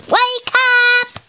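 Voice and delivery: high clock voice; alarm clock. Acted. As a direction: ring it out like a clock.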